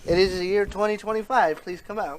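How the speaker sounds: loud, formal call into the drain; mock official announcement. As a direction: announce it like a rescue team.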